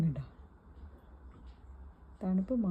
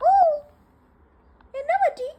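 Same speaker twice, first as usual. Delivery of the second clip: high pet talk; 4 dB louder than usual. Real, not acted. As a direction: coo the question to the dog. Talking to dog, high pet voice. (നായയോട് കൊഞ്ചൽ)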